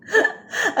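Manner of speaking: a choked laugh breaking into a sob; real tearful laugh-cry. Real, not acted. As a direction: laugh through tears, voice catching high. Tearful birthday message to mother.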